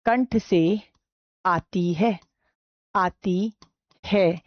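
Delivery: slow, halting dictation, phrases repeated. Shown as a demonstration. Teacher dictating where visarga sounds from, slow dictation. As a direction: pace it for pens to catch up.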